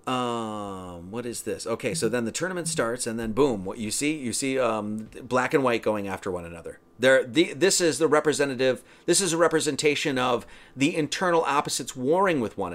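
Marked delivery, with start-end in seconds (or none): none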